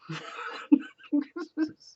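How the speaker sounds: high, long laugh. Real, not acted. Teacher laughing at the old nun's logic, high laughter. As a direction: let the laugh roll out.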